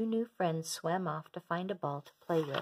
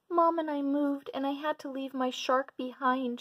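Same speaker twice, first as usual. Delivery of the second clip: put-on much higher, tearful child voice of a young remora; sobbing little girl; 8 semitones higher than usual. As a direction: sob it out like a small lonely fish. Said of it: "she sobbed".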